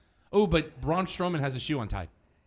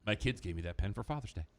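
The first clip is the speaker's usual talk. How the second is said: low, hushed mock plea; imagined aggrieved muttering, much lower; Paul Heyman; 9 semitones lower, 11 dB quieter, faster than usual. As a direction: quietly voice his imagined protest. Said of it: "And Heyman's like".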